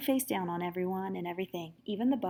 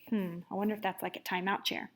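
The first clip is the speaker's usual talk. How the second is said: whispered aside; 2 semitones lower, 7 dB quieter than usual. Real, not acted. Reader whispering an aside to listening kids. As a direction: lean in and whisper it.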